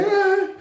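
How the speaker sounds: very high mocking baby-fuss squeal; a shrieking imitation of cooing adults. Mocking carers cooing at a baby, a very high squeal. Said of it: "they're laughing with the baby".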